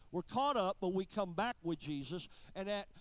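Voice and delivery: loud, punchy sermon lines; full-force preaching. Acted. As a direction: drive the end-times point home.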